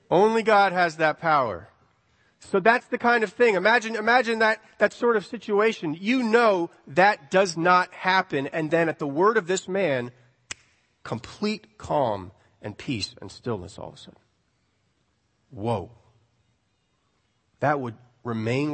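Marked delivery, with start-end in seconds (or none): none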